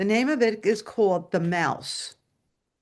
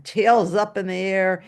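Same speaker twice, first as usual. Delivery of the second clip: ordinary talk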